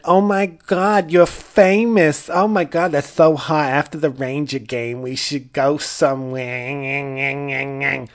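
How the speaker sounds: hammed-up, drawn-out Long Island accent, starting high and loud; mock-gushing Long Island groupie, stretching the last word; Long Island skank. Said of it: "Long Island skank with me, who was like".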